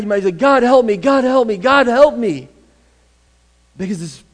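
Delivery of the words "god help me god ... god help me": loud, higher desperate plea; desperate cry. Acted. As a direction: cry out for help, urgently repeating. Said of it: "But, but if it's really bad".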